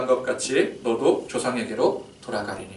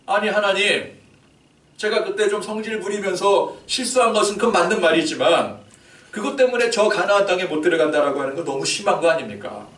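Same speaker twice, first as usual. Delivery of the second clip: higher, pleading with God; pleading Moses; 6 semitones higher, 4 dB louder than usual. Acted. Moses pleading with God over 성질 부리면서, higher and pleading. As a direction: argue your case to God, wounded.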